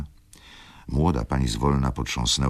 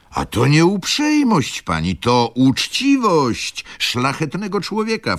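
high, loud, eager steward voice; steward cutting in; 6 dB louder than usual. Acted. Steward correcting the young lady, high and loud. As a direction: jump in with high indignant warmth.

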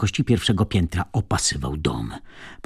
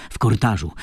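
ordinary delivery, nothing marked

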